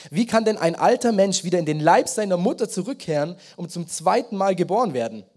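solemn scripture reading from the pulpit. Acted. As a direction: read the passage out like proclaiming it. Reads John 3 aloud.

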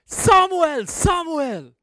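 high, loud booming call from above; voice of God calling. Acted. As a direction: call his name like a voice from heaven. God's voice calling in story.

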